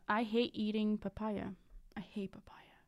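ordinary delivery

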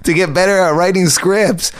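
high, laughing, slowed; can't believe it, laughing through it; laughing disbelief. Real, not acted. Comic laughing at his friend's plan, high and amused.

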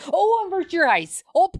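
high, hammed-up exclamation; mock alarm. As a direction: cry out in playful horror. Mock horror at a spoiler.

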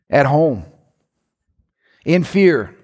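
ordinary delivery

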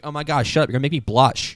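loud, high, hammed-up Delco accent; shriek-flattered Delco girl, played big. Delco girl answering a Frenchman at a bar, loud Delco accent. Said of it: "That is the Delco accent".

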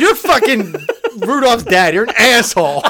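loud, high, laughing fake fury; half-yell the insults while cracking up; laughing mock rant. Real, not acted. Friend laughs while ranting at his co-host about Christmas.

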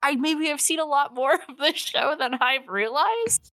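high-pitched, mock dawning realization; surprised realization. Viewer realizing they know the show better, surprised. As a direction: let it dawn on you, voice climbing.

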